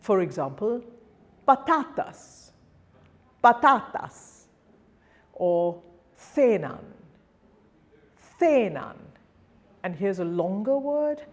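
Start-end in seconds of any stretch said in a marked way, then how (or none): 1.5-4.4 patatas with the middle TA punched, pa-TA-tas; higher pitched pa-TA-tas, stressing patatas second-last; penultimate stress. Shown as a demonstration. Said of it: "then the penultimate syllable". 6.1-9.0 cenan with the first syllable strong, THE-nan; penultimate stress. Shown as a demonstration. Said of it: "then the penultimate syllable".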